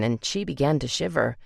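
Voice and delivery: ordinary delivery, nothing marked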